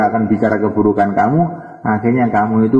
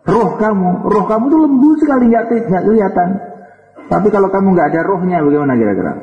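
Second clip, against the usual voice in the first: excited; 10 semitones higher, faster than usual. Acted. Preacher excitedly describes soul.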